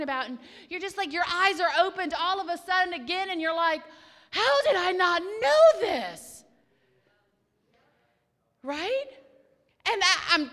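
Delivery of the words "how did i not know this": slow, louder, astonished question; half-mock wonder; amazed. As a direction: marvel at it slowly. Retells her own amazement.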